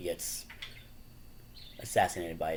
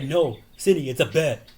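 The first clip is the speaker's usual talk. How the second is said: higher, louder movie-line retort; mock loud retort; 6 semitones higher, 8 dB louder than usual. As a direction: bark it back as a bet-taker. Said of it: "He's like".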